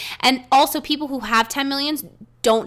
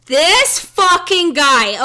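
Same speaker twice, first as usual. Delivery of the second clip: slow, high, loud disbelief; exasperated groan; 7 semitones higher, 8 dB louder, much slower than usual. Real, not acted. Venting to her friend about William, slow and exasperated. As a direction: drag it out, totally fed up.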